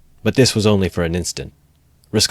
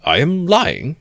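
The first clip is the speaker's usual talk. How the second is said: mock incensed; 10 semitones higher, slower than usual. A narrator's reading. Lawyer echoing the accusation, feigned indignation. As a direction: toss it back in feigned outrage. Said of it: "apparently incensed".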